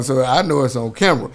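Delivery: ordinary talk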